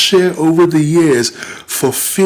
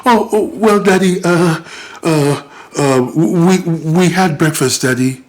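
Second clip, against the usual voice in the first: put-on higher, stammering child caught out; flustered child; 4 semitones higher than usual. Children stumbling over their answer when their father questions them, mildly voiced. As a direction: fumble for the lie like a scared kid.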